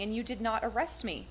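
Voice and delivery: ordinary delivery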